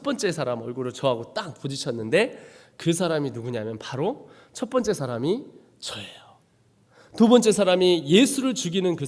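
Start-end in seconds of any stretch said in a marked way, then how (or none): 2.8-6.3 hushed reveal. Real, not acted. Revealing himself as the soldier.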